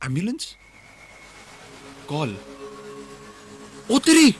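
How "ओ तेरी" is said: rising, louder to a shouted idea; detective shouting in sudden realisation; excited eureka. Acted. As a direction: bursts out as the idea hits.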